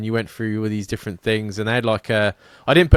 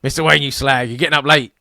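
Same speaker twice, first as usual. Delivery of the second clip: loud Cockney butler's scolding, pitched up; rough London Cockney; 4 semitones higher, 6 dB louder than usual. Acted. Rough London Alfred wakes Bruce Wayne, loud Cockney scolding. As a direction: give a rough London telling-off. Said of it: "a London streets to".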